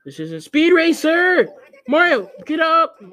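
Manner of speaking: high, very loud shouting; frustrated yell. Real, not acted. Player scolding Mario to get up, frustrated yell. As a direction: yell at the character in exasperation. Yelling at Mario during a mishap.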